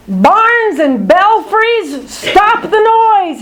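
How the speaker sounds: high, slow bat screech. Acted. Bad Egg Bat maddened by echoes, high screech. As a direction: screech in frustration. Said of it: "he screeches".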